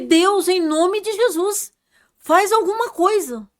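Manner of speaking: slow, loud, high pleading cry; desperate cry to God. Retold or imitated. Praying over a dying man, crying out to God loud and slow. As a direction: beg heaven with everything you have.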